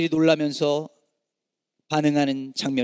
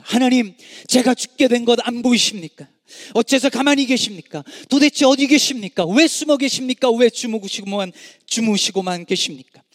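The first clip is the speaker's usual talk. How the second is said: raised, higher voice crying out to God; anguished plea; 5 semitones higher, 4 dB louder than usual. Acted. Preacher voicing a sufferer's complaint to God, raised anguished plea. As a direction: plead loudly as if abandoned by God.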